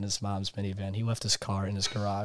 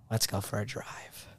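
ominous quiet; 8 dB quieter than usual. Retold or imitated. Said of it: "And then he's like".

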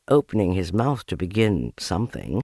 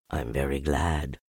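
low, soft bear, gently pleased; quietly glad, in a low bear voice; happy Pooh; 8 semitones lower, 6 dB quieter than usual. A narrator's reading. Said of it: "said Pooh happily".

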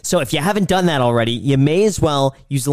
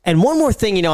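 usual speaking voice

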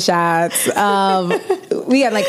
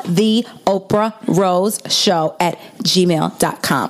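slow and clear; 2 semitones lower, slower than usual. Shown as a demonstration. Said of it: "Since I talk very fast".

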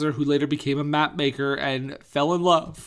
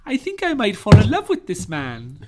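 higher, louder put-on romantic heroine; Kristen Scott Thomason; 6 semitones higher, 5 dB louder than usual. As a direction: swoon theatrically in a raised voice. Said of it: "Kristen Scott Thomason goes".

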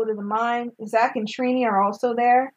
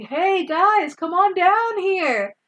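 high, slow calling out; calling up from the pit; 8 semitones higher, slower than usual. Retold or imitated. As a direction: call up to friends from far below. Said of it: "he calls from the bottom".

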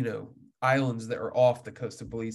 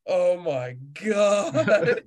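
dismayed guide; 5 semitones higher, much slower than usual. Retold or imitated. Guide reacts to the spooked fish.

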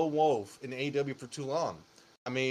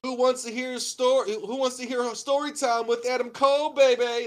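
high, loud hyped promo voice; Adam Cole impression; 10 semitones higher, 7 dB louder than usual. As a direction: yell it like a wrestling promo. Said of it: "he says the traditional thing".

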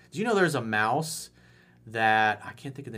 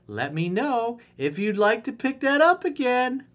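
put-on girl voice; 7 semitones higher than usual.

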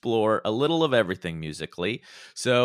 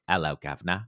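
Cockney accent; 6 semitones lower, 4 dB quieter, faster than usual. Shown as a demonstration. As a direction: drop into a corny Cockney greeting, pitched down. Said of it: "a Cockney accent is I say".

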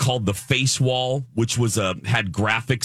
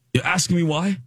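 higher, lightly British, incredulous; a hint of a curt Brit asking back; dismissive British accent; 8 semitones higher than usual. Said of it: "he was so dismissive".

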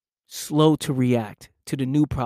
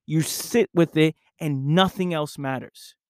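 ordinary talk

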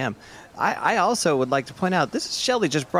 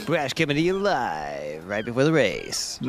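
slow, drawn-out live radio intro; radio announcer; slower than usual. Acted. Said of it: "live on the radio".

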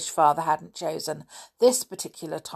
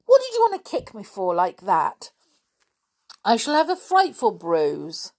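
indignant complaint, raised pitch; scowling; 3 semitones higher, 5 dB louder than usual. A narrator's reading. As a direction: complain crossly about being hurt. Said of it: "She scowled at him".

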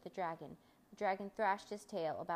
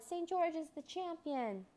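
high, drawn-out victory cheer; cheering crowd cry; 10 semitones higher, slower than usual. A narrator's reading. Crowd celebrating the boy's victory over the dragon, cheering cry. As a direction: hail the champion in a high, slow cry. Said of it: "they shouted".

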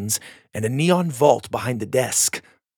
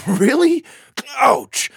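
loud, much higher excited yelp; eager then yelping; 7 dB louder, slower than usual. A narrator's reading. Dallas getting his toes stepped on, loud yelp. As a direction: blurt out, then yelp in pain.

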